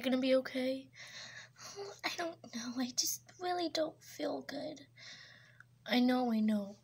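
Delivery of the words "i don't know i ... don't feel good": whispered, feeling ill; weak whisper. Acted. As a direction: breathe it out sickly. Sick girlfriend complaining.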